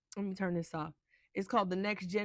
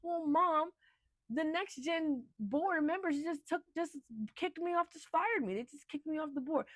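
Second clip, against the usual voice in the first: whiny daughter; 8 semitones higher than usual. Retold or imitated. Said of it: "She said".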